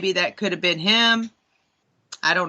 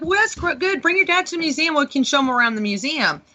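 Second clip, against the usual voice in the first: mock eager Frank; 8 semitones higher, faster than usual. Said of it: "And he was like".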